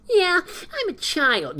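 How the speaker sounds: very high, slow, childlike cartoon voice; childish Sonic voice. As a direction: play Sonic as a little kid, stretched out. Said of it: "so then he goes".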